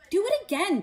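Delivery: put-on high, loud demanding boy; ordered. A narrator's reading. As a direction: snap the order at dad. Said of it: "Dudley ordered".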